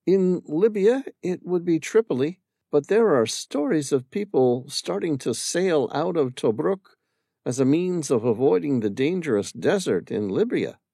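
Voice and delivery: put-on a young woman remembering her husband; Amira's voice. Amira telling the stranger about her late husband, wistful.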